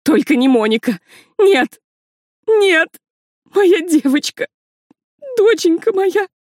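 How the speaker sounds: high, loud anguished wailing; heartbroken wailing scream. A narrator's reading. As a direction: scream in grief for a daughter. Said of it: "Раздался душераздирающий крик".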